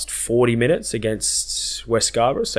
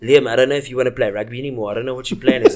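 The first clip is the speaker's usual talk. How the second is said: imitating his dad; 3 semitones higher, 3 dB louder, faster than usual. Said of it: "he goes".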